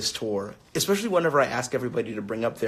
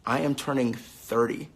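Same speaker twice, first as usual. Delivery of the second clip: ordinary delivery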